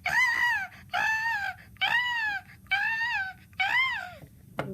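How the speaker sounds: repeated high loud shrieks; piercing acted little-girl screams; screaming. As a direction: scream in short bursts at top pitch. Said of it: "She screamed".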